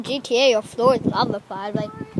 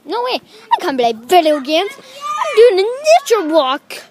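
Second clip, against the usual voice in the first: indignant protest; 7 semitones higher, 6 dB louder than usual. Real, not acted. Refusing games during a nature walk, indignant protest. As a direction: protest it, climbing in pitch and volume.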